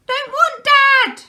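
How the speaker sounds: put-on very high, loud, slow toddler bellow. A narrator's reading. Baby refusing dad, very high loud bellow. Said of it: "bellowed the baby".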